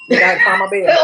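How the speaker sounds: loud, rounded preacher tone; church bell voice. Acted. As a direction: ring it out like a church choir. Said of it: "you found your bell for that one".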